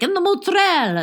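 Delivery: high, teasing mimicry of her mozzarella; imitated regional mozzarella. Teasing Christine's drive-thru order, high mimicry of mozzarella. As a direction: say mozzarella as her accent has it, pitched high. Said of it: "you were like".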